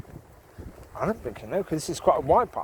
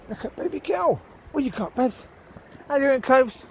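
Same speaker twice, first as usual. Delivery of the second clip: talking to the dog; 8 semitones higher than usual. Real, not acted. Talking to her dogs.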